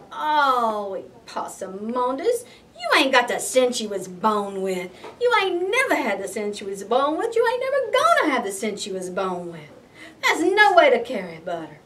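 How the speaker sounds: loud exasperated scolding, repeating the refrain; mama scolding. Acted. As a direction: scold him like a fed-up mama. Mama scolding her son.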